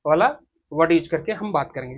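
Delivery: ordinary talk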